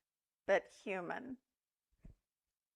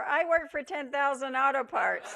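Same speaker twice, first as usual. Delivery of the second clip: mock yell over shoulder; 5 semitones higher, 4 dB louder than usual. A narrator's reading. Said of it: "He yells over his shoulder".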